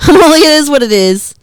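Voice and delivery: loud laugh breaking into the words; laughing hard while repeating it. Real, not acted. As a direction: laugh your way through the line.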